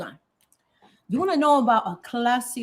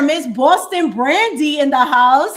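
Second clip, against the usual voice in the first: louder, higher, drawn-out hype announcement; hype shout-out; 5 semitones higher, 8 dB louder than usual. Real, not acted. Host welcoming a viewer to the stream, loud hype shout-out. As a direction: announce her arrival like an MC. Hyping a viewer who joined.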